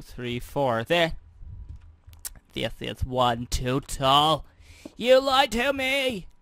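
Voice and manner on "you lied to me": high squeaky accusing voice. Acted.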